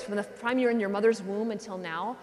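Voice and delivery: usual speaking voice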